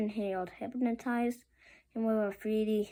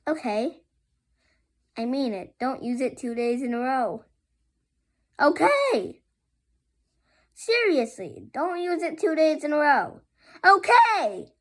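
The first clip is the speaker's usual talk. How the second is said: from firm to a much louder, higher shout; building insistence; 4 semitones higher, 9 dB louder than usual. Acted. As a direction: get more desperate each time. Melvin insists on his rule.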